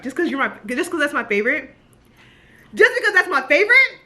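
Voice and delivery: high, loud, rising disbelief of delight; incredulous gush. Real, not acted. Fan repeating the character's sweet line, high and delighted. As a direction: swoon in disbelief.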